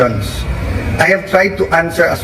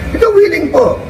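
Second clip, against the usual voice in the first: much higher, keen agreement; eager reply; 13 semitones higher than usual. Retold or imitated. Senator retelling his keen answer to the secretary, eager high voice. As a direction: chirp it back eagerly.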